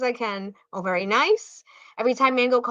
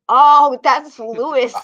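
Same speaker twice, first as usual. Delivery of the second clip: loud, drawn-out "oh" of recognition; slow, loud moment of it clicking; loud realisation; 2 semitones higher, 9 dB louder, slower than usual. Real, not acted. Realising who the person is.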